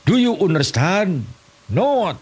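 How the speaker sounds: high, comically broken English; mock English lesson. Comedian playing a silly English class, high broken English. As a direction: quiz it like a clumsy English teacher.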